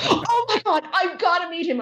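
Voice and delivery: high, thrilled imitation of a fan; squealing fangirl. Fan hearing Tuxedo Mask is nearby, imitated squeal. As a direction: squeal it like a mock-starstruck fan. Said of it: "And she goes".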